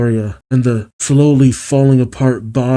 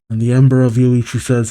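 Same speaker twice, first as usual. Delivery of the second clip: ordinary delivery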